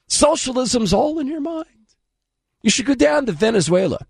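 sarcastic high parody of the line; mocking. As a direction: throw his words back, scoffing. Mocking Trevor Noah's line back.

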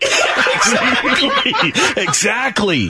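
high, long, laughed-out word; laughing agreement. Real, not acted. Host cracking up at the caller's coalition joke, laughing. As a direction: stretch it out through laughter.

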